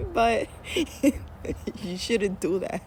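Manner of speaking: giggling through a mild admonishment; amused, laughter spilling over; real laughing. Real, not acted. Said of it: "I'm laughing because".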